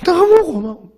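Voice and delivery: high, bright mock thanks to God; overjoyed high thank-you to God; grateful cry. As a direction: squeal a lightly voiced "너무 고마워". Thanking God, retold thought.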